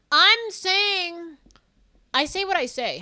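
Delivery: insisting loudly; loud protest. Real, not acted. Streamer protesting loudly to chat. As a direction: defend herself loudly to chat.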